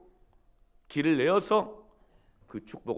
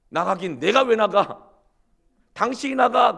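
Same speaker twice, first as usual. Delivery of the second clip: higher, loud indignant retort; wife yelling back; 10 semitones higher, 10 dB louder than usual. Retold or imitated. Jeolla wife yelling back at her husband. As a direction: throw it right back at him. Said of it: "그랬더니 부인이".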